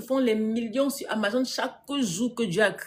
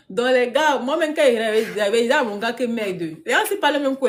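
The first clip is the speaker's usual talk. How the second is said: loud, high, talking through laughs; laughing, raised-voice amusement; real amused laughter; 4 semitones higher, 6 dB louder than usual. Real, not acted. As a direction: tell it while cracking up. Cracking up at viewers' comments.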